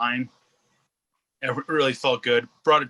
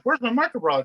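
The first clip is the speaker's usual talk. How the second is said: higher, lightly whiny mimic; a hint of an impatient buyer's whine; complaining customer asking for order, mock whine; 9 semitones higher, faster than usual. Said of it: "they're just like".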